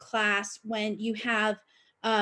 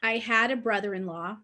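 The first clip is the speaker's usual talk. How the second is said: normal talking voice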